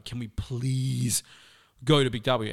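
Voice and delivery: drawn-out, imploring "please"; retold begging, stretching out the plea; pleading kid. Child begging mum to go.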